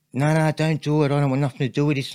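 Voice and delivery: mate begging him not to do it; anxious plea. Retold or imitated. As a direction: beg him to stop, worried. Mate pleading not to steal.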